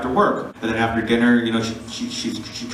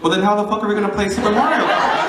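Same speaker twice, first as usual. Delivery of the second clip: laughing exasperation; 8 semitones higher, faster than usual. Retold or imitated. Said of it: "I looked at her and I went".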